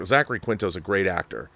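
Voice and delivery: ordinary delivery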